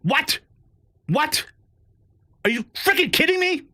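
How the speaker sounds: climbing disbelief, almost squeaking; stacked shocked whats into an outburst; incredulous. Real, not acted. Reacting to spending list.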